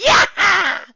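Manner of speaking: loud high shrieking laugh; wild cackle. A narrator's reading. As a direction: shriek with glee. Villain mare laughing.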